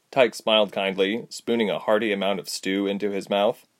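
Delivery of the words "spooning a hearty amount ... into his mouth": usual speaking voice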